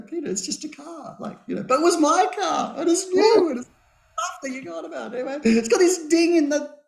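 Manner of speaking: a lightly hammed-up cosmic lecture; the universe teasing him over his dented car; universe talking. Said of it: "Universe is speaking to me, going".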